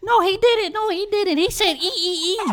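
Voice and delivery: shrill and dismissive, like the teacher; mock teacher. Mocking the teacher who said he spelled it wrong, shrill and loud.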